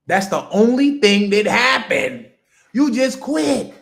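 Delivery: loud, slow, hammering each word; forceful slow declaration; emphatic loud. Real, not acted. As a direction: drive it home to the audience. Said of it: "hammering the point".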